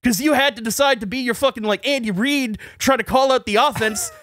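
high-pitched heated rant; retold yelling back. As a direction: act out yelling back in an argument. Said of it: "I'm yelling back at him".

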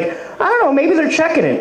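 high-pitched innocent mom imitation; evasive mother. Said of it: "She's like".

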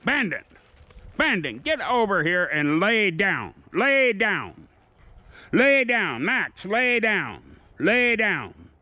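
high, insistent lay-down orders; firm dog commands. Real, not acted. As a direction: keep pushing each dog down, firm and high. Training dogs to lie down.

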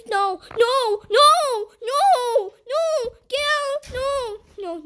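high, loud string of refusals; panicked protest. Real, not acted. Player fending off a game threat, panicked cries. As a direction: cry out to drive it away.

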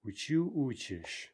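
Учу stressed on "чу", учишь on "у"; shifting stress. Shown as a demonstration. Teacher giving the key forms, Учу stressed last, учишь stressed first. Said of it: "shifting stress".

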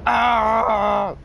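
startled cry. Real, not acted. Startled cry during gameplay.

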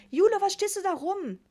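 loud, high-pitched mother's yell; near-hysterical scolding. A narrator's reading. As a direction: snap loudly at your idle daughter. (fast hysterisch, laut schimpfend)

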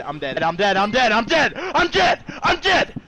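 panicked. Real, not acted. Rising panic.

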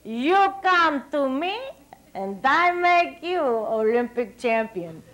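slow, imitated Hungarian accent. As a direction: play the coach promising gold in a Hungarian accent. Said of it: "deep Hungarian accent".

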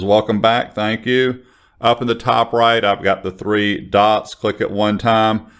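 usual speaking voice

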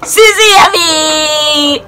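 loud, slow hype build to a cheering call; excited hype. Real, not acted. Vlogger cheering in her number one YouTuber, loud and excited. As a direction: hype the crowd up for the big name.

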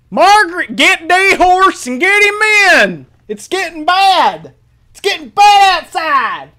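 high, drawn-out hollering warning; panicked yelling. Acted. Acts out panic at coming sandstorm.

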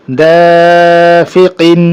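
"দাফিকিন" with long held "দা", short endings; stretched reading. Shown as a demonstration. Teacher joining syllables for students, "দা" drawn out. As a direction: sustain the first vowel of "দাফিকিন". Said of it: "টানা পড়ে চলে যাবো".

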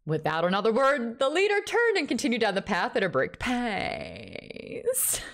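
put-on film character voice, rising in pitch; Chappie. Shown as a demonstration. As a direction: impersonate the character, stretching the last word. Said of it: "Read it as Chappie".

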